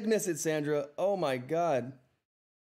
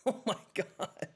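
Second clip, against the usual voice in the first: laughing disbelief; 6 semitones higher, 4 dB quieter, slower than usual. Real, not acted. Yet another donation.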